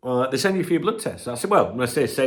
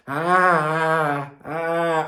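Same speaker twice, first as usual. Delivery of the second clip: pained wincing; 5 semitones higher, 3 dB louder, much slower than usual. Real, not acted. Wincing at blood test plaster.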